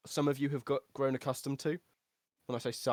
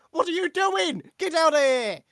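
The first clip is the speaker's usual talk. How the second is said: high, loud furious shout; startled woman shouting at a teleporting stranger; angry NPC shout; 14 semitones higher, 9 dB louder than usual. Acted. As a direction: yell at the intruder to get out. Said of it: "sees you and says".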